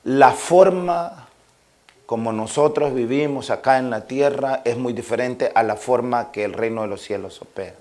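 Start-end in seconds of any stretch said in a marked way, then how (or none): none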